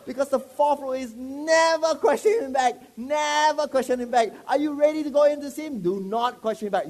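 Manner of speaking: loud, high, hammered rule; forceful preaching. Acted. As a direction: drive the rule home loudly.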